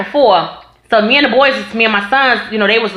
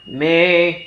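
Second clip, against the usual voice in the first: low flat brother voice; 6 semitones lower, 4 dB quieter than usual. Retold or imitated.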